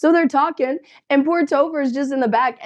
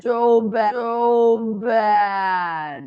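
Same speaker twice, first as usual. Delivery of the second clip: "so bad" with long stretched vowels; "so bad" lingering longer and longer; drawn-out emphasis; much slower than usual. Real, not acted. Drawing out her scorn for accent.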